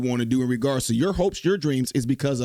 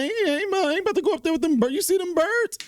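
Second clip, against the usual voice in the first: much higher squeaky scared refusal; mock brother refusing. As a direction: pitch up like a spooked brother. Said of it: "asked her brother".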